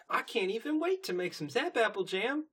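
high-pitched eager filly voice; Apple Bloom. Retold or imitated. Apple Bloom to Granny before jam making, high eager voice. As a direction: lift it right up, excitedly. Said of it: "Apple Bloom's like".

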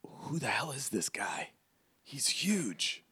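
astonished stage whisper. Acted. Bodyguard whispers to another about the giant, hushed. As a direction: whisper it urgently to a colleague. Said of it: "one whispers in his ear".

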